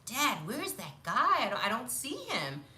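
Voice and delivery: lightly acted little-kid puzzlement; puzzled child to dad. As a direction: play a curious child asking Dad. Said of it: "And I said".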